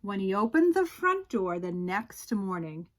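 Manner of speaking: ordinary delivery, nothing marked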